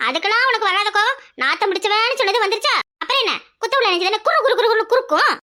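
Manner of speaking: high-pitched, needling taunt; jeer, drawing out the repeated syllables; mocking. Woman goading her rival about a guilty conscience, mocking.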